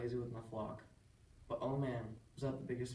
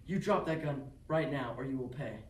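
raised-voice warning, louder and urgent; angry yell; 2 semitones higher, 6 dB louder than usual. Acted. Sheep herder to armed neighbor threatening his son, yelling. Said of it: "I was yelling".

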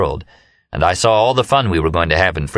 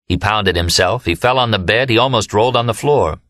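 plain reading voice

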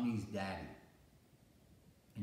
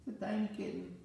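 tiny kitten voice; 12 semitones higher, faster than usual. Acted. Contrasts tiny kitten with large lion.

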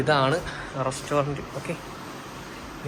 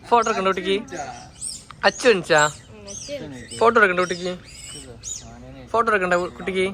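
high, loud, cheery calling; 8 semitones higher, 6 dB louder than usual. Real, not acted. Vlogger calling the children awake for the photoshoot, loud and high.

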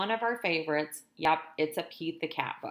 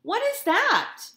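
put-on much higher, louder girl-friend voice; curious Callie; 8 dB louder, slower than usual. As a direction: ask with wide-eyed curiosity.

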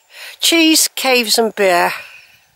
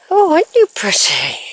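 higher cooing to the horse; pet talk; 5 semitones higher than usual. Real, not acted. Admiring the horse, higher coo.

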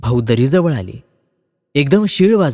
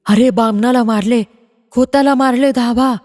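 put-on old woman's alarm cry; 9 semitones higher than usual. Old woman crying out to the village for help. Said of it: "एका म्हातारीने ओरड केली".

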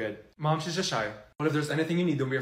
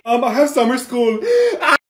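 high, loud gasping sob; hammed-up crying; 5 semitones higher, 6 dB louder, slower than usual. Student has summer school, hammed-up sobbing. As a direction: wail it through overdone tears.

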